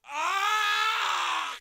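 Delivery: long, high, very loud "aah"; real rage scream; frustrated scream. Real, not acted. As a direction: scream at full voice. Screaming at failure.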